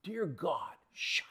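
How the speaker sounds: exasperated whisper; whispered aside. Acted. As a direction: breathe it under your breath, fed up.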